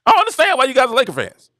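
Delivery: put-on high, puzzled young voice; confused kid. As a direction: sound like a kid who just doesn't get it. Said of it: "I'm like".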